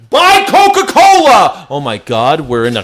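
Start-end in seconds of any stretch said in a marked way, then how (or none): 0.1-1.7 very high, drawn-out hammed-up yell; mock ad shout. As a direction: holler it like an overblown ad slogan. Mimicking ads that bombard him.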